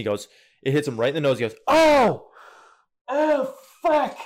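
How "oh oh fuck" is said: high, loud startled cry of pain; pained yell. Retold or imitated. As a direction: yell out as the ball smacks your nose. Dad hit in the face.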